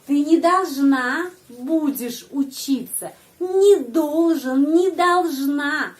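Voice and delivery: не joined to the next word, slow and deliberate; не должна glued together, spoken slowly; slow teaching demo. Shown as a demonstration.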